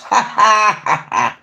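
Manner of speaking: real drawn-out laugh. Real, not acted. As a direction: burst into a cackle. Laughs at her own haters remark.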